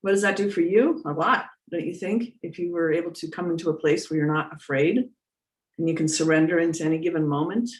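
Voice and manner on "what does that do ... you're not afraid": ordinary talk, nothing marked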